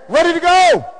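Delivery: high, loud imitated chant shout; sudden call-out. Voice from the back rousing twenty people, loud chant. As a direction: holler it to rally a room. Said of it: "hear the voice saying".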